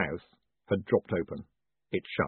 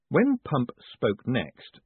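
usual speaking voice

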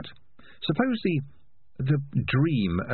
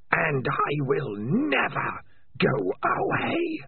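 much higher, slow rasping voice; slow eerie creature voice; menacing Spider; 10 semitones higher, much slower than usual. Acted. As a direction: threaten slowly from inside his head.

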